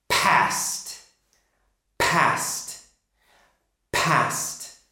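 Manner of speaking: Past with a strongly aspirated P; Past, exaggerated P puff. Shown as a demonstration. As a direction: burst the air out on the P of Past. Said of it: "Make it very strong".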